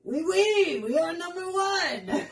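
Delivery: high, loud mock-French cheer; hammed-up national chant breaking into laughter; boastful French nationalists. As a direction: crow it like a gleeful caricature patriot. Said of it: "In France, they're like".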